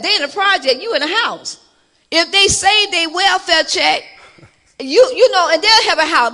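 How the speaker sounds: mock telling off son; retold scolding. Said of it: "And I told him, I said".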